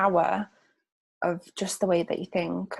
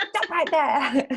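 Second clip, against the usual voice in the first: higher-pitched halt through laughter; playfully police yourself, breaking up laughing; laughing mock command; 7 semitones higher, 4 dB louder, much slower than usual. Speaker catching herself using a banned word, laughing mock command.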